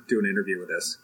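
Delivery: ordinary delivery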